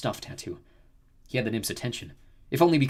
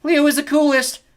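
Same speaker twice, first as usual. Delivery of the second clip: high, loud parroted yell; echoing shout; 12 dB louder than usual. A narrator's reading. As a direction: shout it back like an echo. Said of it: "Echo shouted back".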